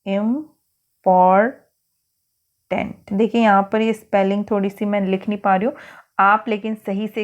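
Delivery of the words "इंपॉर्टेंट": इंपॉर्टेंट very slowly as इम, पॉर, टेंट, with pauses; dictated by syllable. Shown as a demonstration. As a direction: dictate इंपॉर्टेंट piece by piece for writing. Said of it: "इंपॉर्टेंट word लिख दीजिए". (लिखवाने के लिए इंपॉर्टेंट को रुक-रुककर इम, पॉर, टेंट बोलना)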